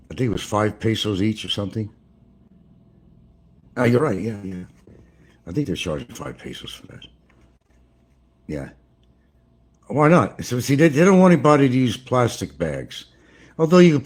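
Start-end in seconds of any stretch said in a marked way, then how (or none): none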